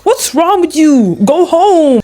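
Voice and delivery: high, slow mocking rebuff; voicing the manga guy brushing him off; mock character voice. Reading the manga, a high mocking rebuff.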